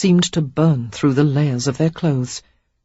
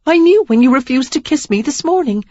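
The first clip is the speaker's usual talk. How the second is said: hurt accusation; 10 semitones higher, 6 dB louder than usual. Acted. Sarah accusing him.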